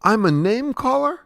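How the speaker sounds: high, climbing question; incredulous. Acted. Dweeb answering a charge of name-calling, incredulous. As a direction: echo the charge with rising disbelief.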